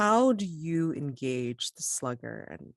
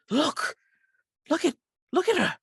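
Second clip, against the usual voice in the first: high, loud, hurried cry; hammed-up alarm from across the table; mock scream; 13 semitones higher, 6 dB louder than usual. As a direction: blurt it out high and fast, as if screaming. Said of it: "somebody screams".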